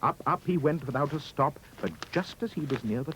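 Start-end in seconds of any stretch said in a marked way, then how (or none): none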